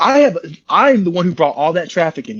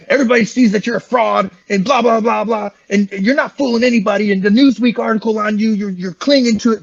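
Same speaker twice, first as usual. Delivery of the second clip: higher-pitched mock tirade; retold angry rant; 5 semitones higher than usual. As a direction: imitate a mad man's accusations, pitched up. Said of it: "he gets all kinds of mad".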